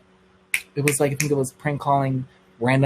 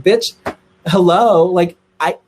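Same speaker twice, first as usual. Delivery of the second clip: louder and higher mock call-out, fed up; exasperated outburst; 4 semitones higher, 6 dB louder, slower than usual. To her silent date at the party, loud exasperated call-out.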